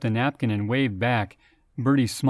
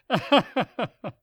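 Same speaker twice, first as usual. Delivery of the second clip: high, booming hammed-up guffaw; bellowing laugh; 12 semitones higher than usual. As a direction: roar with laughter. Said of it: "Roger bellowed".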